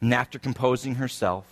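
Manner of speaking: low, soft, subdued telling with long pauses; somber sermon story. Real, not acted. Preacher tells congregation of little Nolan's cancer, somber and hushed. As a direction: tell it gently and low, leaving space between phrases.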